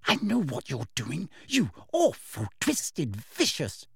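seething hushed insults; whispered fury. A narrator's reading. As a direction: whisper it through your anger. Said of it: "she whispered as Umbridge walked".

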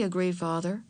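plain reading voice

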